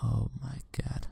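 shaken, whispered under the breath; breathless whisper. Real, not acted. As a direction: whisper it in shock. Shaken after scare.

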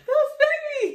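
high, loud laughing plea; laughing squeal. Real, not acted. Laughing while being slapped.

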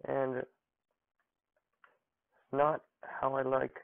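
usual speaking voice

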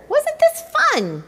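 gleeful squeak. A narrator's reading. Tacky enjoying himself.

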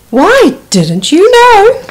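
high slow hooting owl. Acted. Owl answering the mouse, high and slow. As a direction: stretch it out like a wise old owl.

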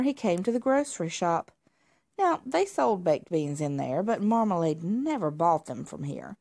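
ordinary delivery

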